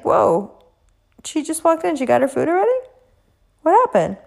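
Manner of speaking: high, disbelieving whoa; shocked that she cut the line; waiting diner gasps in high-pitched disbelief; indignant surprise. Retold or imitated.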